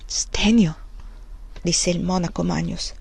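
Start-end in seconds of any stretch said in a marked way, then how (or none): 0.0-0.8 breathless whispered question; barely voiced, repeating the name; whispered echo. A narrator's reading. Magnus echoes the prior's question, whispered.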